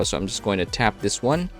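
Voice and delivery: ordinary delivery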